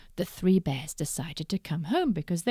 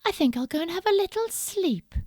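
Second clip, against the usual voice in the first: Goldilocks voice; 10 semitones higher, 3 dB louder, faster than usual. Acted. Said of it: "said Goldilocks".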